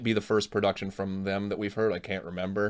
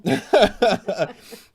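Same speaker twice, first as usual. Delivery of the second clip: high laugh; 13 semitones higher, 8 dB louder than usual. Real, not acted. Laughs at mom's reply.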